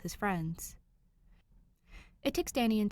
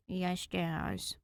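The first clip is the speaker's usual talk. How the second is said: softer, sulky yes; dejected reply; 4 dB quieter than usual. A narrator's reading. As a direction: answer like a scolded kid. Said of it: "in the same dejected tone".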